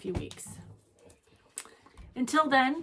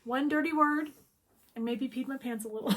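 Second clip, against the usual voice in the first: ordinary delivery, nothing marked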